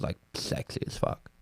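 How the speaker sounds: whisper. Real, not acted. Whispered aside.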